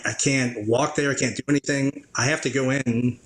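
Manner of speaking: normal talking voice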